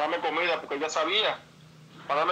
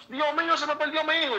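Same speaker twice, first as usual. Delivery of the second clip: much higher, panicked mother's cry; desperate mother; 9 semitones higher than usual. Retold or imitated. Mother searching the beach for her son, higher panicked cry. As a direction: call out for a lost son in panic. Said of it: "buscarme a la playa desesperada".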